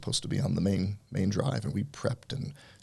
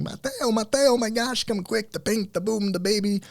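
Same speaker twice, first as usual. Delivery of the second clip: much higher, louder frantic retold scream; panicked screaming; 4 dB louder than usual. Painter screaming to his boss over the phone, panicked high yell. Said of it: "I hear him screaming".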